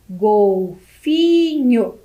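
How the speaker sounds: "Golfinho" split very slowly into gol-fi-nho; syllable by syllable. Shown as a demonstration. Teacher counting syllables aloud for children, "Golfinho" as gol-fi-nho very slowly. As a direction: draw out "Golfinho" in three slow beats, gol-fi-nho. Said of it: "Será que o golfinho?".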